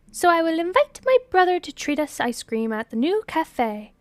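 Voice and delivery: high, loud, insistent character voice; excitable friend. Acted. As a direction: announce the plan loudly and eagerly. Acted excitable friend proposes ice cream.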